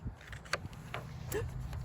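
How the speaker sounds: soft surprised gasp. Acted. Reader hears egg cracking.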